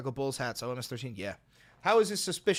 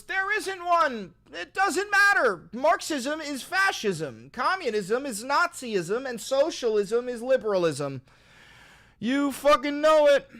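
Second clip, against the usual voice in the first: high, loud hammed-up rant; mock shouting rant; 9 semitones higher, 5 dB louder, slower than usual. Streamer ranting at donors in mock outrage, shouting high. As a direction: yell it like a parody zealot.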